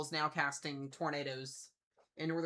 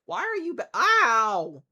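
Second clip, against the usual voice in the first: pained yelp; 6 semitones higher, 17 dB louder, slower than usual. Real, not acted. Cat hurts him.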